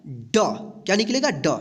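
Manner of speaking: ड dropped very low and short; D sound. Shown as a demonstration. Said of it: "डी का जो sound निकलेगा". (ड को नीचे और छोटा बोलें)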